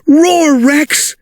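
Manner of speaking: high, eager call; eager urging. Acted. Trixie urging Rex to scare the dinosaur, high eager call. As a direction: urge him on brightly. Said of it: "said Trixie".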